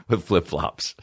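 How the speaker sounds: ordinary talk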